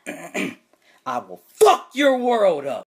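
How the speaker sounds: hammed-up promise of doom; menacing threat. Teacher threatens student.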